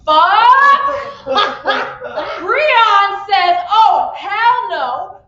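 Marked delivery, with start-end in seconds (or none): 0.0-0.9 loud, rising shout of disbelief; hammed-up outrage, word by word; outraged disbelief. Character finds the wrong food. 3.7-5.2 loud, slow, drawn-out refusal; hammed-up indignation; indignant refusal. Character rejects the wrong order.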